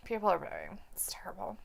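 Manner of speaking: hushed whispered aside. Real, not acted. Host whispers about the booing crowd to co-host. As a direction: whisper it like a secret comment.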